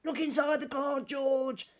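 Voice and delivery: very high, small animal voices; the animals nudging George to read; very high coaxing chorus. A narrator's reading. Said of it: "said the animals".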